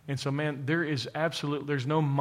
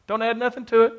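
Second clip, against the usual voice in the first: laughing; 9 semitones higher, 5 dB louder, faster than usual. Real, not acted. Laughs while saying it.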